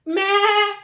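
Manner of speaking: high loud bleating meh; goat bleat. Acted. Goat answering Duck with a loud bleat. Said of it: "we have to use the voices".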